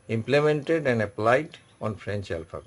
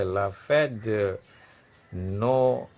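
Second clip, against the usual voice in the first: slow "la fête de", then drawn-out "Noël" in two parts; pause inside Noël; 2 semitones lower, much slower than usual. Shown as a demonstration. Teacher repeating the example, slow "Noël" with a pause in it. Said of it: "pause for a moment".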